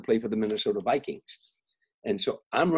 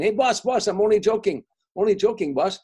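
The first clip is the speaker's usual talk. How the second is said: put-on much higher and louder Arabic-accented clerk, calling after him; Arabic-accented clerk shouting after the departing customer; Arabic accent, backpedalling; 10 semitones higher, 8 dB louder than usual. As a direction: call out in an Arabic accent, hastily taking it back. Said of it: "like an Arabic accent or something".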